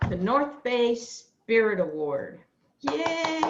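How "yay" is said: cheer. Real, not acted. Cheering award winner.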